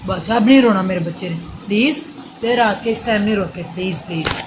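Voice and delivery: mother hushing her crying son late at night, loud and pleading. Real, not acted.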